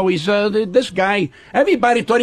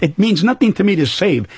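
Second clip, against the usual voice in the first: usual speaking voice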